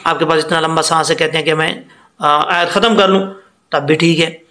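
ordinary talk

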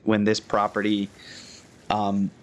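ordinary delivery

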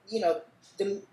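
ordinary delivery

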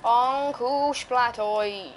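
very slow, stretched-out nickname; drawn-out catchphrase. Pretend. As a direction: draw every syllable right out. Drawls catchphrase while playing.

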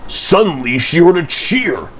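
ordinary delivery

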